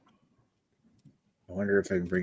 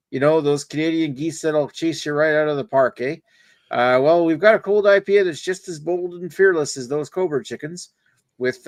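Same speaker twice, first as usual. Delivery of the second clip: higher, hammed-up Canadian hoser voice; 7 semitones higher than usual. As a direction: lay the Canadian accent on thick. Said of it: "why is it so Canadian?".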